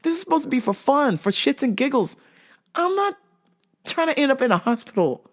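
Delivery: laughing. Real, not acted.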